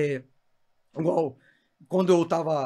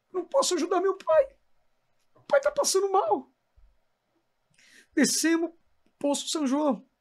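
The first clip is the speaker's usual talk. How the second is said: much higher, tearful retelling; voice breaking; 8 semitones higher than usual. Real, not acted. Preacher recounting his father's stroke, crying. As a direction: speak through real tears.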